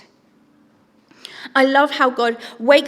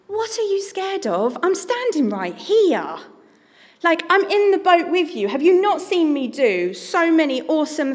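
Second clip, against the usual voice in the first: higher-pitched, exasperated disbelief; incredulous chiding; 5 semitones higher than usual. Acted. God to disciples scared in the boat, incredulous. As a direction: chide them, amazed they could still doubt.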